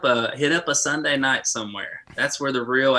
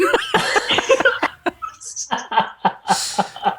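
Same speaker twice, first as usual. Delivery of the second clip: laughing at himself. Real, not acted. Laughs at own story.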